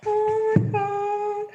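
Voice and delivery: very high, drawn-out "oh my God"; amazed squeal. Real, not acted. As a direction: squeal it slowly in sheer amazement. Amazed by next card.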